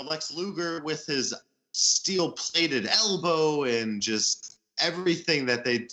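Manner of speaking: ordinary delivery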